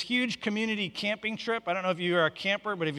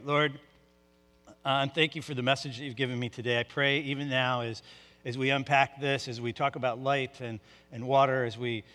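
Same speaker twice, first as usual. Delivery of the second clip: soft, low, hushed prayer; pastor's hushed pulpit prayer; 6 semitones lower, 5 dB quieter than usual. Real, not acted. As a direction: pray quietly and earnestly to the Lord. Pastor prays before the sermon.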